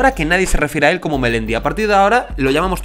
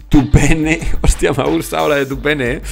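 laughing. Real, not acted. Laughs at chat answer.

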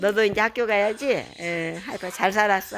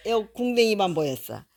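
ordinary delivery, nothing marked

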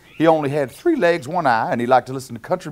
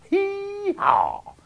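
high, stretched donkey bray. Acted. Reader voices the donkey's bray.

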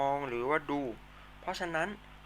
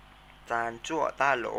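ซานจั่วต้าโหล said slowly, syllable by syllable; slow teacher model of ซานจั่วต้าโหล; much slower than usual. Shown as a demonstration. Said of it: "ออกเสียงตามนะครับ".

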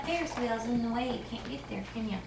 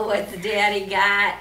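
ordinary talk

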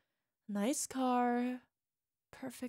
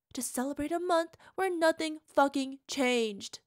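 bitter rant; 3 semitones higher, 7 dB louder than usual. Acted. Partner raging about their tiny apartment, loud bitter rant. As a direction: spit out the disgust at this life.

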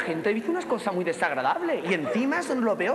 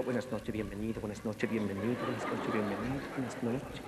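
low, soft monotone repetition; rehearsing under breath; 12 semitones lower, 9 dB quieter than usual. Acted. Nervous performer studying her line, low soft droning. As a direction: drone it quietly to memorise it.

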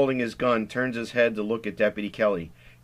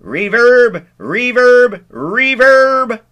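higher and louder, fading echo repeats; echo reverb effect; 13 semitones higher, 14 dB louder, much slower than usual. Acted. Stanley mimicking the pipe's reverb, echoing repeats. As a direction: play the pipe echo with your own voice. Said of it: "It, it sounds like".

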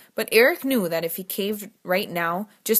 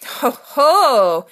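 loud, higher mock-impressed ho ho; exclaiming; 6 semitones higher, 9 dB louder than usual. A narrator's reading. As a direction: crow it out, teasing. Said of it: "Griffin exclaimed".

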